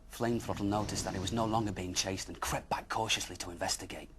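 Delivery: ordinary delivery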